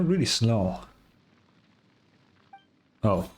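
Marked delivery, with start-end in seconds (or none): none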